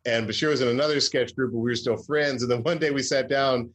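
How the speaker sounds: ordinary talk, nothing marked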